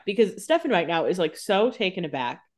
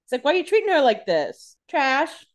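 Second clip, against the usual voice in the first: mock outrage; 9 semitones higher than usual. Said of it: "he's like".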